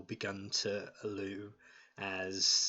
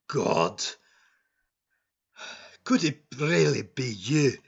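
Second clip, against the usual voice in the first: dwarf's excited apology; 2 semitones higher, 6 dB louder than usual. Acted. Dwarf apologizes after gate opens.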